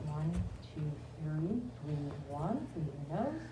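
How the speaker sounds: calm, low counting voice; guided breathing. Acted. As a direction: guide them gently through breaths. Leads breathing exercise.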